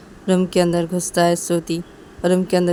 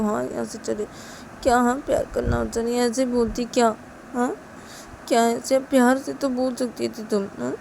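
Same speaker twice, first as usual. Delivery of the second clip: higher, whiny grumbling; lightly voiced sulking girl; sulky complaint; 6 semitones higher than usual. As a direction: pout over being sent away. Shruti sulking about Siya.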